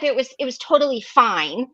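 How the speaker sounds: ordinary talk, nothing marked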